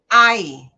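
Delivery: "ai" said as one loud gliding diphthong; ai sound. Shown as a demonstration. Teacher modelling the vowel sound for students, loud. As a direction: sound out "ai" clearly, a to i. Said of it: "ela tem um som de".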